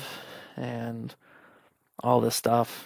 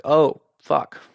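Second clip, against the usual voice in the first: loud, jolted curse of realisation; shocked outburst; 3 semitones higher, 9 dB louder than usual. Retold or imitated. Alone at home, realising it could be cancer, shocked loud curse. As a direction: blurt it as the fear lands.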